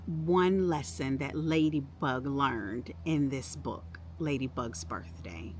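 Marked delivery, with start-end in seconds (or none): none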